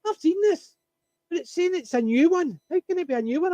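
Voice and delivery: high, incredulous imitation of the wife; mock the wife's baffled recognition; wife recognising the remake, imitated high and incredulous; wife's disbelief. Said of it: "my wife was sitting watching it thinking".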